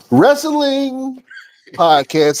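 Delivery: high, stretched announcer finish; show sign-off. Pretend. Host closing after the threatening damn, high sign-off.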